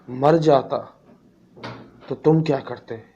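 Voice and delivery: heated, accusing retort in broken bursts; angry father. Acted. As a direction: act out a father angrily challenging the doctor. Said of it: "गुस्से से बाप ने doctor को कहा". (गुस्से से सवाल करता पिता)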